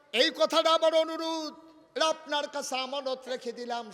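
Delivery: high, loud exhortation; full-force preaching. Real, not acted. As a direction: drive the lesson home to the congregation. Preacher calls to the congregation. (জোরালো উঁচু গলায় ওয়াজ)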